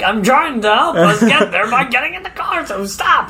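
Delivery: pleading and cracking up; high laughing plea to stop; laughing exasperation. Retold or imitated. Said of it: "would have just been like".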